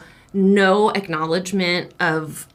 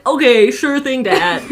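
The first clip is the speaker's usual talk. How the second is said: drawn-out, louder sarcastic okay; slow dismissive yes, breaking into laughter; mock agreement; 4 semitones higher, 4 dB louder, slower than usual. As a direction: humor dad without meaning it. Brushing off dad's advice.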